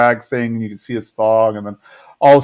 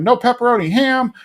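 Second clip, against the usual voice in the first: much higher put-on voice for a retold reply; high-pitched imitation; 11 semitones higher than usual. Kid answering a favourite-pocket question, high-pitched imitation. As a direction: jump up high, playing the other kid. Acted back-and-forth over pocket flavours.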